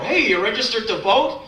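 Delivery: lightly voiced eager canvasser; a hint of a chirpy clipboard guy; pushy canvasser. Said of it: "fifty-five-year-old guy".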